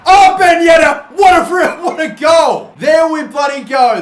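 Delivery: real, loud high yell of joy over the free kick; goal celebration shout. Real, not acted. Gamer screaming at his own free kick goal, overjoyed shout. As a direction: let it burst out, laughing mid-shout.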